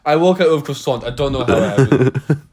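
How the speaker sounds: hammed-up Frenchman accent; a light, playful French accent; mock French accent. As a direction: put on a comic French accent. Riffing as the hapless Frenchman.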